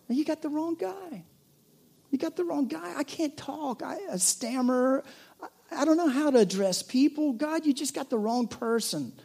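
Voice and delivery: high, protesting voice of a reluctant man; pleading Moses. Acted. Moses pleads with God that he's the wrong guy, high and protesting.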